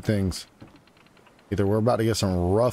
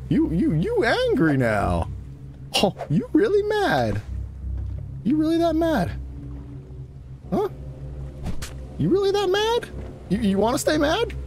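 mocking taunt. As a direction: needle the shark in a silly high voice. Taunting an angry shark.

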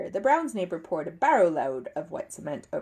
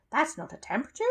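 higher, mildly relieved correction; relieved exclamation; 7 semitones higher, faster than usual. A narrator's reading. Mrs Bird examining the thermometer, exclaiming with relief. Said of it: "she exclaimed with relief".

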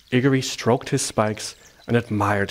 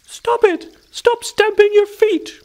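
very high, loud squeaky order; mouse shouting; 7 dB louder, slower than usual. A narrator's reading. Field mouse orders hedgehog to stop stamping, shouting. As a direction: shout it slowly in a tiny mouse voice. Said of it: "shouted Felicity Fieldmouse".

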